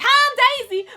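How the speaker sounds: high, loud, cheery princess greeting; Daisy impression. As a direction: chirp it like an overexcited cartoon princess. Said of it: "to be like".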